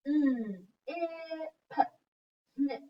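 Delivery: snip sounded out slowly as s, n, i, p; segmenting sounds. Shown as a demonstration. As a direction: break snip into its single sounds. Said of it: "let's segment snip".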